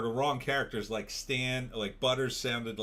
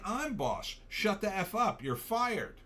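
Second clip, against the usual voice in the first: mock bossy director; 8 semitones higher, slower than usual. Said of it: "he'll say".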